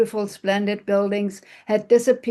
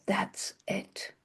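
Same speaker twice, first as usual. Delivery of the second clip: breathless whisper of a sudden idea; 12 dB quieter than usual. Acted. Wife whispering to herself at sunrise, a hushed whisper. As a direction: breathe it like a secret.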